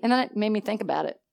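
ordinary delivery

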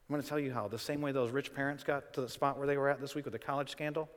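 ordinary talk, nothing marked

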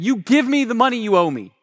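high-pitched, forceful acted demand; angry demand. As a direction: act out a servant demanding his money. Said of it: "grabbed him by the neck".

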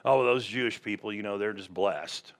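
low, quick offhand aside; softly voiced casual remark, dropping low; imitating people's talk. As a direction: toss it off like imitated common chatter. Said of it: "people still talk like this".

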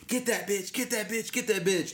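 mock crowd yelling. Retelling the crowd at a fight video, in a mock yell. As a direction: imitate girls egging on a fight. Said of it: "nine or ten bitches screaming at them".

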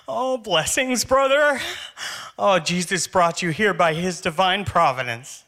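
high, gushing blessing voice. Retold or imitated. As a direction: gush it like a hippie believer. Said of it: "he's like".

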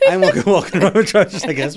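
giggling through the words; laughing. Real, not acted. Laughs at own image.

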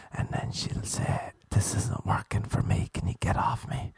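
hushed whisper. Retold or imitated. Telling the joke of a girl rejecting him, whispered. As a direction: drop to a whisper for the punchline.

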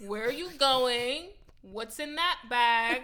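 loud, very slow, pointed questions; suspicious interrogation. Acted. Rider challenging a suspicious man about his bag, loud interrogation. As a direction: demand answers, stretching each word.

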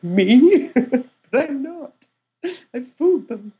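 high, laughing modest denial; playful incredulous protest; mock disbelief. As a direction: squeal a loud "Me?", then confess in amusement. Acts out disbelieving reply to praise.

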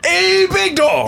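high, slow drawn-out hype; hype-man voice. Retold or imitated. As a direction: stretch it out, admiring yourself.

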